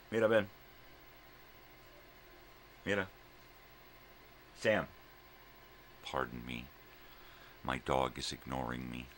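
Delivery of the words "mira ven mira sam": calling the dog. Real, not acted. Owner calling her dog to come inside, raised voice.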